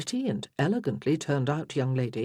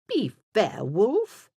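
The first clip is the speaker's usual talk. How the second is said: raised, haughty reproach; 6 semitones higher, 5 dB louder, much slower than usual. A narrator's reading. Freya rebukes her brother Wulfric, haughty. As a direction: rebuke him loftily, lifting on the name. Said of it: "Freya said haughtily".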